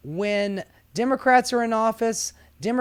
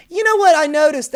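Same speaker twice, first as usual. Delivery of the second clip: excited; 7 semitones higher, 9 dB louder, faster than usual. Real, not acted. Perking up with a thought.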